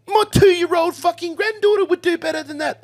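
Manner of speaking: high, loud mocking Kiwi voice; old Kiwi hand mocking the box, high loud Kiwi voice; Kiwi put-down. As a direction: scoff like a blunt old Kiwi. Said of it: "old Kiwi fella".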